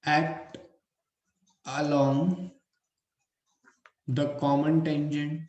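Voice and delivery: slow, measured classroom dictation; word by word, with pauses to write. Acted. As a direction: dictate in short phrases for note-taking. Dictates points for students to write.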